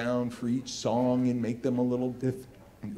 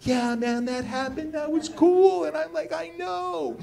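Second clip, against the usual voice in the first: higher, excited back-and-forth; like twelve-year-olds buzzing; giddy kids; 11 semitones higher, 4 dB louder than usual. Retold or imitated. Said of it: "And we're like".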